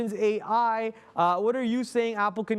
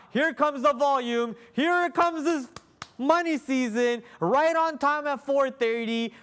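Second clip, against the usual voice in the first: excited; 5 semitones higher, 3 dB louder than usual. Real, not acted. Apple earnings drop live.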